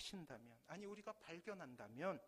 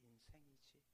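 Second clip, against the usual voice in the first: fatherly, gentle; 5 semitones lower than usual. Acted. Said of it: "아버지가 껄껄 웃으시면서".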